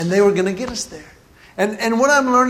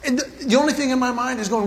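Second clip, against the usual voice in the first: ordinary talk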